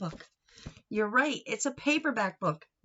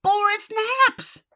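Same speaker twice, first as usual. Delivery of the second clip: high exasperated punchline; 12 semitones higher, 9 dB louder than usual. Acted. Running joke, sleepy kitten.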